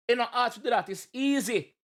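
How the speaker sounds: ordinary talk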